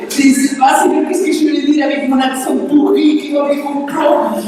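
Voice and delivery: half-hearted replay of panic before preaching; panicked prayer. Retold or imitated. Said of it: "je n'ai pas paniqué".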